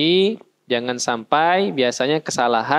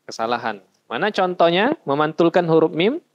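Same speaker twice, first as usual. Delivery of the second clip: ordinary talk, nothing marked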